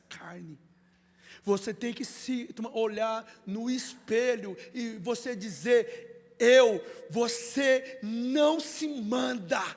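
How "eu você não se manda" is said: loud, rising exhortation that breaks into song; fiery preaching. Acted. Pastor showing the congregation how to speak to themselves in the mirror, shouting and half singing. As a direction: preach it at full force until it sings.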